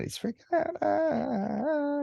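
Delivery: put-on high frightened child's shriek; freaking out. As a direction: squeak it out like a terrified kid. Kid terrified of monster.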